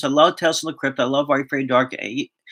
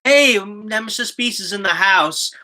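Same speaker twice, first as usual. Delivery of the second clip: excited greeting; 7 semitones higher, slower than usual. Real, not acted. Greeting a viewer who arrives.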